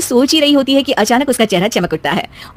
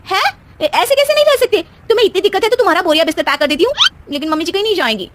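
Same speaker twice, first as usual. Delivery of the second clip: much higher, fast and scolding; fierce defence; 9 semitones higher, faster than usual. Acted. Wife scolding her husband to keep her mother-in-law home, fast.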